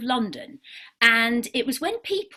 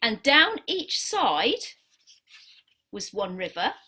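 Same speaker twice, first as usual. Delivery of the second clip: story narration; 3 semitones lower, 4 dB quieter than usual. Acted. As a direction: tell it gently, like a picture story. Tells the dog-drawing story.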